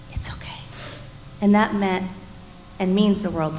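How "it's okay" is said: very soft, comforting it's okay; gentle soft okay. Retold or imitated. Husband comforting grieving wife, very softly. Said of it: "sat with me in the moment and said".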